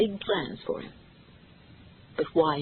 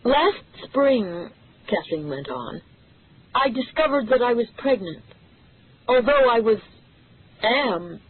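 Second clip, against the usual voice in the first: nervous revelation; 5 semitones higher than usual. A narrator's reading. Kathleen tells reporters her news.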